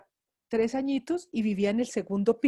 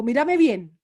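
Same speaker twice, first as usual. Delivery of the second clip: stern command; 8 dB louder, slower than usual. Retold or imitated. Stern mother demanding attention.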